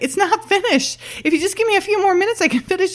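very high, rushed mock pleading; fighting protest. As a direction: imitate a flustered, arguing colleague. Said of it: "like, fighting Margaret".

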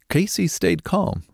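ordinary delivery

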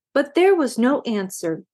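plain reading voice

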